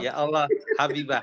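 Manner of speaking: giggling mid-recital, half-serious; laughing declamation. Shown as a demonstration. (deklamasi sambil tertawa)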